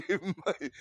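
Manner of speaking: high giggling, words dissolve; real laughter. Real, not acted. Comedian laughing at the story, high giggles. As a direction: lose it to laughter.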